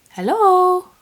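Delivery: loud, high-pitched hello; calling out. Real, not acted. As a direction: call out sharply to get attention. Calling to a camera not focusing.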